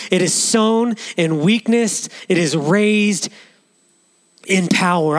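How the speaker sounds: measured, solemn reading of the verse; scripture reading. Acted. Reads scripture aloud.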